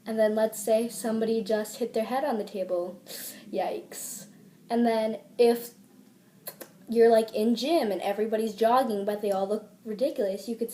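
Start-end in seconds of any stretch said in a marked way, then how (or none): 3.5-4.3 very soft, unvoiced yikes; hushed reaction. Shown as a demonstration. Reacting to someone hitting their head, hushed. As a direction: breathe it out almost in a whisper.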